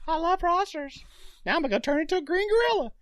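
very high, childlike cartoon voice; Beast Boy impression. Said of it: "a very high-pitched voice".

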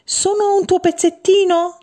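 high, small hopeful voice; a small piece pleading to belong; little one's high question. Acted. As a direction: ask it up high like a tiny child. Little piece asks again.

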